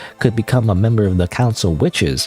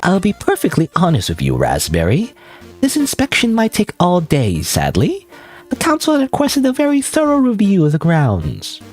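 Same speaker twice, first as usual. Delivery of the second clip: lightly voiced high clown; cheerful clown, a bit higher; 7 semitones higher than usual. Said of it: "It's a clown voice".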